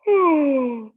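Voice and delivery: loud, high stretching yawn. Acted. Mister Snore yawning to the bellhop, hammed-up yawn. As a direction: yawn it out big and drawn.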